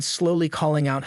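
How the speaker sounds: plain reading voice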